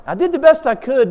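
high-pitched mock protest; mock excuse. Replaying the worker's excuse.

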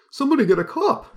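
high, loud squeaky clown cry. Acted. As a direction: yelp it out like a circus clown. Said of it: "that's my clown voice".

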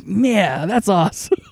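a hint of a wide-eyed Drax nodding; Drax, impressed. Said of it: "this nod of like".

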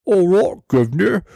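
hammed-up British "guv'nor" greeting, high and swooping; mock British accent. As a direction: put on a cheeky, exaggerated British accent. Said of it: "like, accents".

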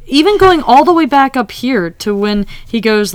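normal talking voice

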